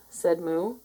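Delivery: ordinary delivery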